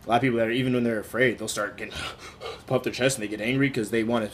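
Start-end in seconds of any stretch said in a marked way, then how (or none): 2.0-2.6 a quick unvoiced indrawn gasp; mock startled inhale; mock sharp gasp. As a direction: gasp like someone squaring up. Imitating people puffing up.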